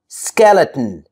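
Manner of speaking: Skeleton as skel-it-n. Shown as a demonstration. Teacher modelling natural speech, Skeleton with bare N ending. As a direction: drop the last vowel in Skeleton, T straight into N. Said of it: "I don't say skeleton".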